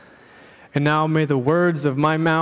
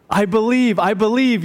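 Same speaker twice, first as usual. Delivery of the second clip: high, louder desperate I believe; eager yell; 6 semitones higher, 3 dB louder than usual. Retold or imitated. Dangling man yells up to the voice, desperate. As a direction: shout it back fast and eager. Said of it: "yelled back the man".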